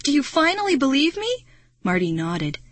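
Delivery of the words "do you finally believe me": high, desperate question; urgent raised-pitch plea; crying out. A narrator's reading. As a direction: cry it out, demanding an answer. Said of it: "I cried".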